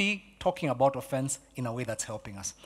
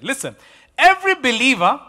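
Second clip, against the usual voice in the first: ordinary talk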